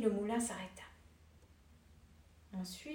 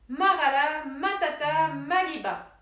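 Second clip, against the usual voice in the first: louder incantation, falling at the end; magic stop word; 7 dB louder than usual. A narrator's reading. Dwarf teaching the stop spell, loud incantation. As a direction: proclaim the magic words.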